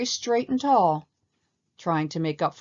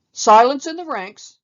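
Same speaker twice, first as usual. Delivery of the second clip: loud, higher barked order; bellowing; 8 semitones higher, 13 dB louder than usual. A narrator's reading. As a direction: roar the troop into silence. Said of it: "bellowed Colonel Hathai".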